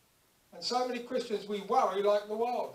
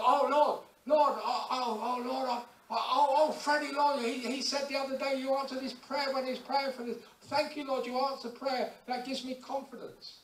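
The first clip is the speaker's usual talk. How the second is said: excited thanksgiving prayer; 5 semitones higher than usual. Shown as a demonstration.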